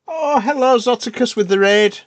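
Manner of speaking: high bright raid welcome; excited greeting. Real, not acted. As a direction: greet the raiders with a lift.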